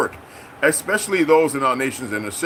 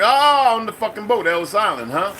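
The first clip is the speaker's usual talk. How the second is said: mocking jab; 7 semitones higher than usual. Taunting a man about his immigrant ancestors, mocking. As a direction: taunt him, voice leaping up on "Oh". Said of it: "And I talk like that to him".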